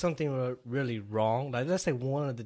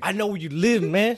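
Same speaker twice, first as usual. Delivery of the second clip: loud, high tough-guy threat; mock gangster threat; 7 semitones higher, 10 dB louder than usual. Dad menaces his own kid, mock gangster.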